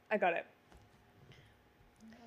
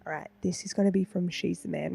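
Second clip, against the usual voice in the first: ordinary delivery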